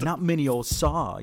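put-on emphatic British-accent movie quote; imitated British accent. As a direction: stretch it out in film British. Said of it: "that scene of Harry Potter".

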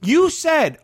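high-pitched, insistent protest to dad; real exasperated pushback; heated arguing. Real, not acted. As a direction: press the point, voice climbing. Heated argument with dad over game.

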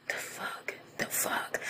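whispered disbelief; stunned whisper. Real, not acted. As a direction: breathe it out in shock. Shocked aside at the news.

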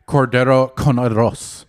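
team name in a Castilian Spanish accent; Castilian Spanish pronunciation. Shown as a demonstration. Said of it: "You say it with the La-, Castilian accent".

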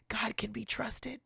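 soft, slow whisper of reassurance. Retold or imitated. A seasoned believer reassuring a struggling friend, in a whisper. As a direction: hush it to them like a secret comfort. Mentor comforting someone learning hard lesson.

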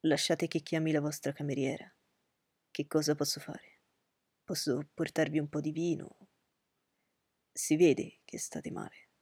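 Darcy tenderly tending a distressed Elizabeth; with great affection. Acted. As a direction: gently offer help to someone unwell. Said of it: "con grande affetto".

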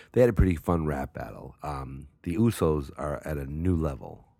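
ordinary talk, nothing marked

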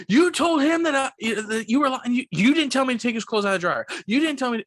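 high, agitated retold complaint, tripping over the words; indignant accusation. Guest accusing the desk clerk of lying, high and indignant. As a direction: imitate an upset guest, high-pitched and insistent.

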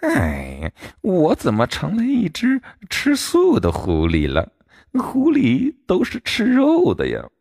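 a baffled fox muttering to itself; fox talking to itself. A narrator's reading. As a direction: fox muses aloud, confused. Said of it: "它自言自语地说".

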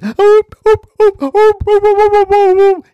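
very high, loud yelps; stung dog yelping. Acted. Dog stung by the bee, high loud yelps. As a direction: yelp like a hurt dog running off. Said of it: "And the dog said".

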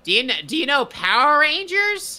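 high, peppy channel promo voice; kids' TV host. Pretend. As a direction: sell it to kids like a promo.

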